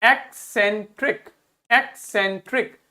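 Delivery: एक्सेंट्रिक in three separated syllables; pronunciation demo. Shown as a demonstration. Teacher asks class to read the pronunciation, syllable-by-syllable एक्सेंट्रिक. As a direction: sound out एक्सेंट्रिक with small gaps. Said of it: "इसका pronunciation पढ़िए".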